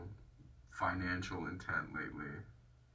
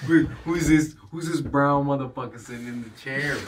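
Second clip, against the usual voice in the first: loud, raised-pitch mock bafflement; mock outraged disbelief; 5 semitones higher, 13 dB louder than usual. Imagined viewers seeing him without hair, loud mock disbelief.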